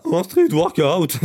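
cracking, squeaky voice. Real, not acted. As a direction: voice breaking on street workout. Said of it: "j'ai la voix qui part en couille".

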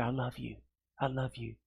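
put-on soft, hushed I love you to a little child; tender whisper. Parent holding a little child close, whispering softly. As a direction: breathe it into the ear like a parent. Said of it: "you whisper in that child's ear".

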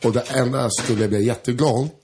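Swedish with a light French accent; understated French accent, lightly in the background; hesitant French-accented Swedish, not overdone. Shown as a demonstration. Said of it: "Han pratar så här".

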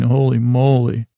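ordinary talk

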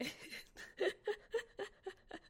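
a bright burst of high laughter. Real, not acted. Host laughing at a listener's joke, high laugh.

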